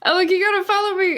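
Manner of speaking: loud, high urgent call; in-character shout. Acted. Archer calling Alec to run along, shouting. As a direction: shout to an ally while fleeing. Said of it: "And then I shout".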